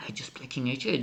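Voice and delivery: whispered, hushed disbelief; mock whisper. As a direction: breathe it out like a stunned player. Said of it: "He says".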